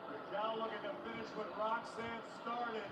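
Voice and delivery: normal talking voice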